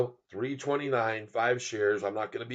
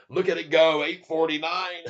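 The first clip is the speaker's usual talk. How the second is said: loud, higher-pitched whoop of words; excited cheer; 4 semitones higher, 8 dB louder than usual. Real, not acted. Streamer watches the price run up, loud excited cheer. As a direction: cheer it on loudly.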